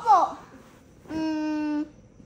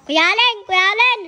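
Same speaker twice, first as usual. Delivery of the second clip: loud, eager call for attention; higher, loud summons; 4 semitones higher, 11 dB louder than usual. Real, not acted. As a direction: call to someone across the room, twice. Child calling for Kuya Allen.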